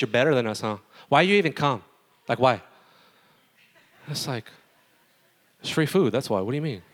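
ordinary talk

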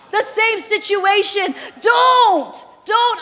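loud, high impassioned pleading; preaching at full force. Acted. Preacher voices the wounded churchgoer, loud and pleading. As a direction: plead hard, don't get my hopes up.

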